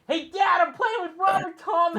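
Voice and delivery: high, shouted mock call to dad; mock fan yell. Mocking Charles yelling to his dad, high shout. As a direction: yell it like an overexcited kid. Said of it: "like, the background".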